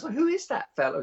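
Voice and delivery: high British woman, puzzled; J K Rowling. Retold or imitated. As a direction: ask curiously in a high British voice. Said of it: "she goes".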